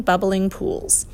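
ordinary delivery

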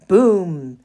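big booming loudspeaker blast; booming thunder voice. A narrator's reading. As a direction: boom it out like thunder. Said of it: "boomed the voice of the loudspeaker".

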